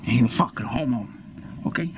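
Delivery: usual speaking voice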